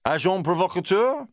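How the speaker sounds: the sergeant answering the inspector in passable French; passable French accent. Acted. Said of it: "he suggested in a passable accent".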